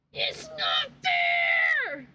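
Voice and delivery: real frustrated yell; shouted complaint. Real, not acted. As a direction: wail it out to the ceiling. Whisper then shout contrast.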